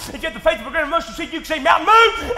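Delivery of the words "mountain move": loud, high command; commanding shout. Retold or imitated. Faith speaking to a mountain, loud high shout. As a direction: order the mountain out of the way.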